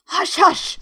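urgent high-pitched shushing; hurried hushing. A narrator's reading. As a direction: hush someone anxiously. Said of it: "in a low, hurried tone".

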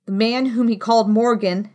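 usual speaking voice

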